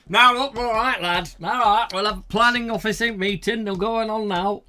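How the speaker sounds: loud, high character voice; planning officer impression. Host plays the planning officer addressing a lad, loud character voice. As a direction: burst in as a blustering official.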